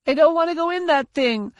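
put-on high whiny child voice; wailing little girl. A narrator's reading. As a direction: wail like a scared little girl. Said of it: "she wailed".

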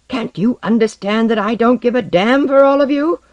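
high, loud exasperation; angry outburst. A narrator's reading. Osden cutting off a teammate, exasperated. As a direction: cut in angrily, voice climbing to the end. Cuts in on patient colleague, angry.

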